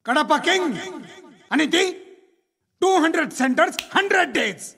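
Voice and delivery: high-pitched, punchy box-office boast; mock film promo. Man mocking violent film hits, hyped promo announcer. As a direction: announce it like a hit-film advertisement.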